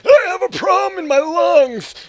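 sudden high, loud wail; mock scream. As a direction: burst into an over-the-top shriek. Mock outburst about own illness.

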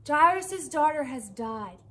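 retold frantic calls, high and loud; frantic crying out. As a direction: cry out for Jesus in a panic, a touch held back. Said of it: "they're frantically screaming".

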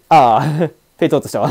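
ordinary talk, nothing marked

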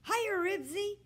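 high, loud happy call to his dog; eager greeting. A narrator's reading. Boy greeting his dog on phone.

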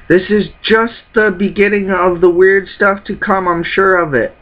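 a touch of worried anime hero; voicing the crew. Captain sends crew after Sanji, lightly voiced urgency.

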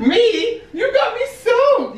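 high, slow fake indignation; mock outrage. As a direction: play offended, overacted.

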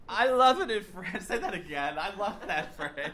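thrilled, laughing praise; delighted. Real, not acted. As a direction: gush with a laugh over the phrase. Delighted by French quote.

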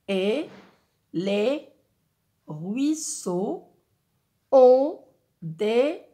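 each word split off, "ont" punched loud; syllable by syllable. Shown as a demonstration. Teacher counts the second verse's syllables for the class. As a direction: tap out the line one syllable at a time. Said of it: "lecture expressive d'un poème".

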